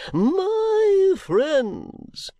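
high, slow, unctuous sermonising; oily preacher voice, drawn out; pompous preacher. Acted. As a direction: play the greasy self-satisfied preacher. Chadband sermon.

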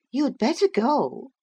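put-on high-pitched girl's character voice; young Catherine. A narrator's reading. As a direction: pitch it well up for Catherine questioning Heathcliff. Catherine warns Heathcliff.

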